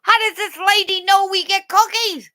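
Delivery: very high puzzled dog voice; high, bright dog wondering aloud; voicing the dogs. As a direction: squeak it out like a confused puppy. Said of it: "They're going".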